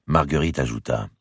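usual speaking voice

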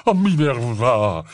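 slow, drawn-out dismay; appalled exclamation. A narrator's reading. Slughorn answering the threat of a duel, appalled. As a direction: stretch the name in shock. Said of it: "s'exclama Slughorn atterré".

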